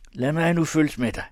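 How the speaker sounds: bursting out, unable to hold back; losing his temper. A narrator's reading. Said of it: "uden at kunne beherske sig".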